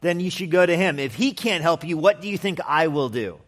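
sarcastic king refusing to help. Acted. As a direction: fire rhetorical questions back at the pleader. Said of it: "kind of as a sarcastic expression".

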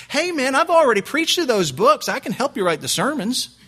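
high, loud comic accent impression; Steve Lawson's accent. Visiting preacher offering sermon help, in exaggerated accent. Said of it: "with that accent".

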